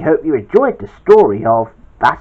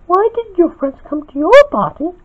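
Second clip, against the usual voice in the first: small, very high falsetto; light falsetto questioner; Peach. A narrator's reading. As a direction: ask in a high little character voice. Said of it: "asked Peach".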